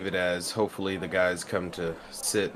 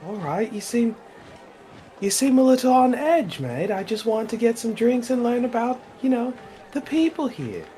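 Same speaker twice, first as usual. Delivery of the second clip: higher, friendly Aussie-ish barkeep; chummy barkeep voice; 4 dB louder than usual. Acted. Bartender chatting up a stranger at the bar, high and chummy. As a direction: play the chatty bartender calling everyone mate. Same character voice.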